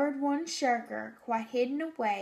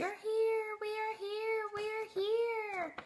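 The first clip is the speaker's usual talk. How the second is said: high, soft little chorus voices; teacher showing her drawing, high small Who voices; tiny Whos shouting; 9 semitones higher, 4 dB quieter than usual. Retold or imitated. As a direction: call it like tiny far-away Whos. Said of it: "the who's are shouting".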